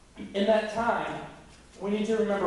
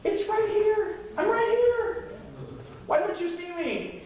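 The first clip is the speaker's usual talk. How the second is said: much higher, slow, pleading call; exasperated God; 11 semitones higher, slower than usual. Retold or imitated. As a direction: wave your arms, I'm right here. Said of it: "sometimes he's like".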